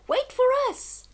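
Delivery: high, loud call across the water. Acted.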